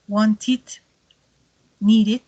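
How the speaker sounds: "wanted" and "needed" ending soft, "wonti", "niri"; "wanted", "needed" con final suave. Shown as a demonstration. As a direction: ease off the final "id" on both words. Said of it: "lo que hace es suavizar el sonido".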